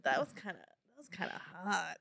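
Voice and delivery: low, soft retold admission; quietly acted smitten remark; hushed smitten aside. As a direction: say it under your voice, as if just realizing. Acting a character's smitten aside.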